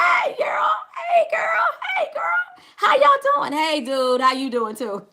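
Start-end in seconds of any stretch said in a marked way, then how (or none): 0.0-3.5 high, loud calling out hellos; bubbly shouted welcome; excited greetings. Real, not acted. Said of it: "say hi to me".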